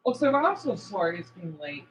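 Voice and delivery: ordinary talk, nothing marked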